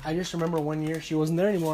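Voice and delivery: normal talking voice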